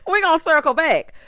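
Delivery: usual speaking voice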